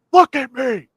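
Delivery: sharp, high-pitched retold shout; imitated yell. Actor barking at the team leader who looked down, imitated yell. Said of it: "yells at".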